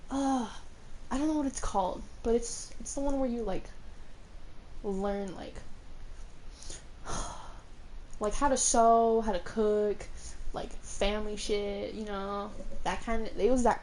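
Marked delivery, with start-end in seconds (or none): 5.6-8.1 long sigh. Real, not acted. Searching for the class name.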